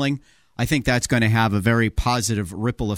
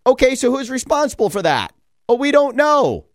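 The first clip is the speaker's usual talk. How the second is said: exasperated mock back-and-forth; 8 semitones higher, 3 dB louder than usual. Acted back-and-forth over busing.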